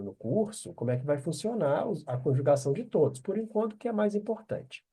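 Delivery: ordinary delivery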